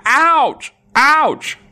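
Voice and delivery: high, loud yelp of pain; cried ouch. A narrator's reading. Goat bitten by the ant, high loud yelp. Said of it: "cried goat".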